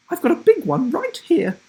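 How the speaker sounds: high, loud and gleeful; cheeky grandma. Acted. Grandma shocks the teacher, loud high glee. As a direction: announce it with mischief.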